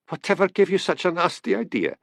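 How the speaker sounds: stiff, affronted retort, pitched high. A narrator's reading. Policeman rebuffing the seer's nasty idea, stiffly. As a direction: bristle at the suggestion, voice lifted. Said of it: "said Hamish stiffly".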